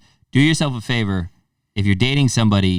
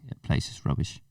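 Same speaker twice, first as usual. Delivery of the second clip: soft mock-Scouse grumble; put-on Liverpool accent; 4 semitones lower, 12 dB quieter than usual. Visitor judging the town, soft Liverpool accent. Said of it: "We could talk like this".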